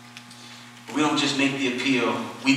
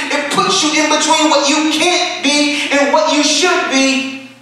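raised, driving sermon delivery; full-force preaching; 9 semitones higher, 6 dB louder than usual. Real, not acted. Preacher exhorting the church on reconciliation, impassioned preaching. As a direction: preach it out with rising intensity.

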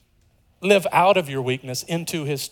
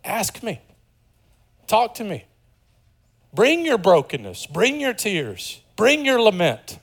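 higher, pleading invitation, slowing on the last lines; voicing the Father; 6 semitones higher, slower than usual. The Father invites his children, a higher, slowing appeal. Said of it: "He says to us".

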